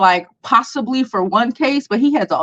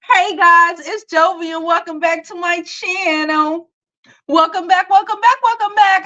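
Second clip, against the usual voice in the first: higher, bright-voiced channel greeting; bubbly vlog intro; 8 semitones higher than usual. Vlogger greeting her viewers, a higher, lively intro. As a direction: sing out the welcome to viewers.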